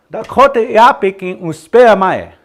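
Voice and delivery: loud, high, brisk Dakota phrase; high model phrase. Shown as a demonstration. Teacher models the phrase for learners, high and loud. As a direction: call the whole phrase out high and strong.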